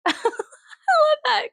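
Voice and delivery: real high, laughing delight; delighted laughter. Real, not acted. Vlogger tickled by a clerk's odd word, laughing with delight. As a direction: laugh through it, slow and high.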